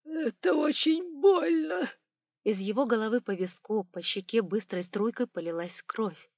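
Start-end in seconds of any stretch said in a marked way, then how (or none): 0.0-1.9 high, slow agonised wail; loud tearful whine of pain; yell of pain. A narrator's reading. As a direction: cry out as if just struck. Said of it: "заорал ростовщик".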